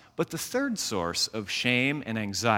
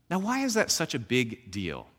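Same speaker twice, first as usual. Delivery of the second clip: normal talking voice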